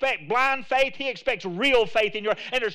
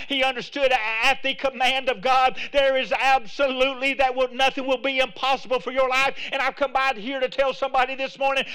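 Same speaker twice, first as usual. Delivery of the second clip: preaching at full force; 4 semitones higher than usual. Real, not acted. Preacher tells the congregation anything is possible at God's command, full-force preaching. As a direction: preach it hard and emphatic.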